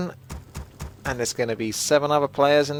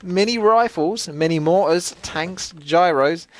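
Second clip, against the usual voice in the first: higher, drawn-out cartoonish read-out; playful character voice, pitched up; put-on message-reading voice; 6 semitones higher than usual. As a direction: ham up a viewer's message in a raised voice.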